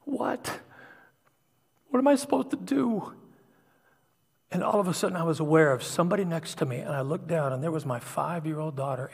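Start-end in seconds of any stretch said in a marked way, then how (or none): 0.0-3.1 high-pitched plea to God; imitating a desperate prayer, much higher; retold begging. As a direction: beg for an answer, voice lifted high. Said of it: "started begging God to show me".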